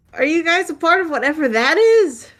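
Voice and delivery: high, loud shouted question; calling over a long distance; yelling from afar. Acted. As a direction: holler it across to a distant group. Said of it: "You don't have to yell anymore".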